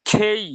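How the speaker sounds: "খেই" aspirated, with a long ei-glide; correct K. Shown as a demonstration. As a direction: puff the k and stretch "খেই". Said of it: "কে, কি এমন না".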